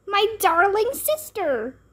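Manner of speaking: high, hammed-up gushing; gushing cry. A narrator's reading. Ivy hugs her new baby sister, hammed-up gush. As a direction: overdo the sisterly love.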